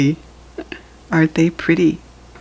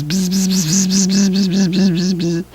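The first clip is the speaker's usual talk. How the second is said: bee buzz; 2 semitones higher than usual. Pretend. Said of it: "And the bee is".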